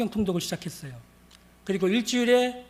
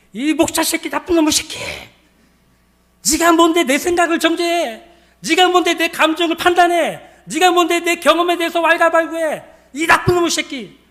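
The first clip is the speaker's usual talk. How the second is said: loud, high angry rant; imitated furious tirade at the pastor; drunken cursing; 8 semitones higher, 9 dB louder than usual. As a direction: hurl accusations in a full acted shout. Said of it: "하면서 저한테 막 욕을 해요".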